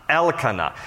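Elkanah with El stressed, then two lighter syllables; stress on first syllable. Shown as a demonstration. Teacher repeating the correct name, Elkanah stressed on El. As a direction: say Elkanah as EL-kuh-nah. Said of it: "the emphasis is always on the name of God part".

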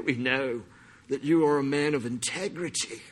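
put-on British accent, buttering him up; soft, hammed-up British smarm; mock British flattery. Pharisees' disciples buttering up Jesus, mock British flattery. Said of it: "I just went British on that".

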